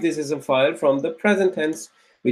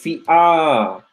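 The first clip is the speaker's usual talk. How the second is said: syllable by syllable; much slower than usual. Shown as a demonstration. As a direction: break Fi'a, shiha, lan into separate beats. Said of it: "then there's an alif".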